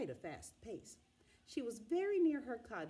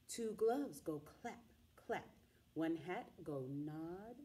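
plain reading voice

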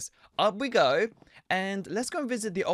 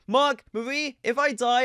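usual speaking voice

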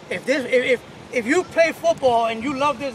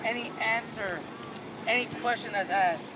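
quiet team talk; 7 semitones lower, 14 dB quieter than usual. Real, not acted. Coach quietly briefing team.

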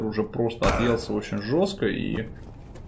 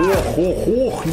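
rising, loud ohs of amazement; excited gasps; 8 semitones higher, 5 dB louder, much slower than usual. Real, not acted. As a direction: gasp out in disbelief. Commentator gasps at big play.

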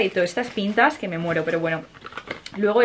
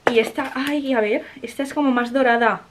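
ordinary delivery

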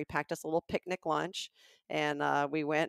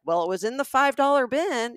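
higher, louder retold protest; pushing back; 7 semitones higher, 6 dB louder than usual. Customer arguing over a hat's price, pushing back. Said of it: "kind of pushing back".

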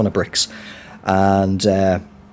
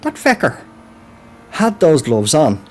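sudden realization, pitched up high; surprised; 7 semitones higher than usual. Retold or imitated. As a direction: hold on, it just clicked. Sudden realization, retold thought.

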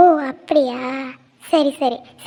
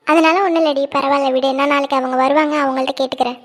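ordinary talk, nothing marked

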